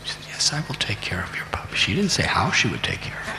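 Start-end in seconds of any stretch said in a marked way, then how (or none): 0.3-1.8 breathless fast whisper, much softer. Acted. Mother quietly promising her son about the puppy, in a whisper. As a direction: whisper the promise as if hiding a secret.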